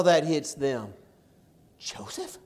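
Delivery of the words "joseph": whispered. Acted. Joseph's stunned first word.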